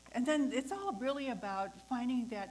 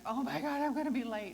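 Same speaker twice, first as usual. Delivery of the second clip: mock panic; 5 semitones higher, 8 dB louder than usual. Imagined panic she didn't feel.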